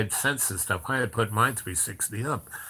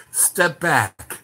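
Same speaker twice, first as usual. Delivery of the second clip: raised, higher retold command; firm command; 6 semitones higher, 6 dB louder than usual. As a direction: order them back sharply.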